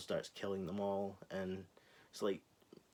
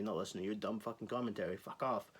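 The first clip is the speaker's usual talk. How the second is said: ordinary talk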